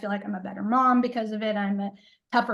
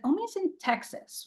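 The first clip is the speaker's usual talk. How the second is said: incredulous; 9 semitones higher, 3 dB quieter than usual. Retold or imitated. Mother disbelieving daughter's claim.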